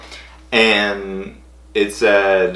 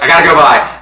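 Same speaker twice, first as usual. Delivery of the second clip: hurried loud goodbye; 6 semitones higher, 9 dB louder than usual. Retold or imitated. Hanging up on a friend for the big call, loud and rushed.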